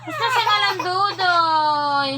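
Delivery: insistent loud call. Real, not acted. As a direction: holler the name long and loud. Calling out to Dudoy.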